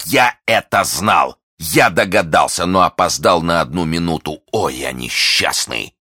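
usual speaking voice

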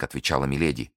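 ordinary delivery

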